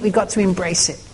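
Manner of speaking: ordinary talk, nothing marked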